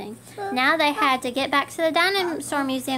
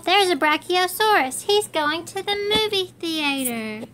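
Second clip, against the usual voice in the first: shouted; 5 semitones higher than usual. Acted. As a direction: call out the find. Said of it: "Geo shouted".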